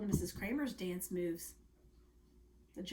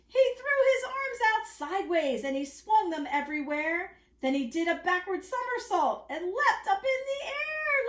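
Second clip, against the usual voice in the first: building, higher and louder read; excited storytelling; 12 semitones higher, 8 dB louder than usual. A narrator's reading. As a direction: read it with rising thrill. Lively climax of story.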